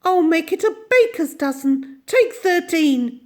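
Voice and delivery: put-on high, cheery generous old lady; cheerfully. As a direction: offer the extra cake gladly. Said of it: "said Missus Corey cheerfully".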